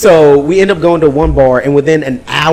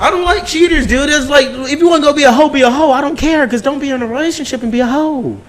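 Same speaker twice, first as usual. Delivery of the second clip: high, laughing rant; excited rant; 10 semitones higher than usual. Real, not acted.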